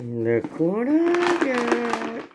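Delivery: slow, drawn-out teasing. Acted. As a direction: stretch it out slowly. Teasing, showing off food.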